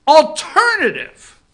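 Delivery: "Alternative" drawn out high and loud; mocking emphasis. Host ridiculing Hill's wording with slow loud stress. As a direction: lean hard on "Alternative," scornfully.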